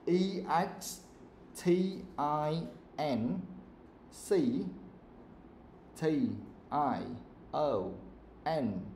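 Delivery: slow, letter by letter with gaps; spelling out. Shown as a demonstration. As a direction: dictate each letter separately for the class.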